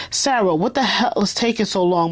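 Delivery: plain reading voice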